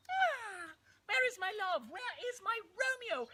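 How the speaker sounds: high, airy maiden voice; Juliet. Acted. Juliet wakes seeking her love, high maiden voice. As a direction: put on a high, theatrical damsel.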